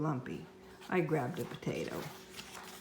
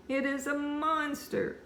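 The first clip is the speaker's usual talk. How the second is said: put-on wailing child, slow; bawling; 10 semitones higher than usual. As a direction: cry it out, slow and high. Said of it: "I bawled".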